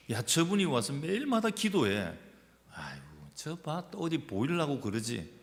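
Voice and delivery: hammed-up disapproving busybody; mock gossipy sneer. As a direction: gossip about someone showing off. Acts out sneering church gossip.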